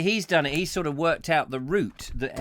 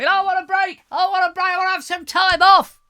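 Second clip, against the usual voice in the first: very high, loud whining plea; mock tantrum; 8 dB louder, faster than usual. Presenter begging for time off, mock tantrum. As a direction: wail it out like a desperate child.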